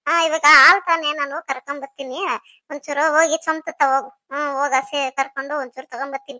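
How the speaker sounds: usual speaking voice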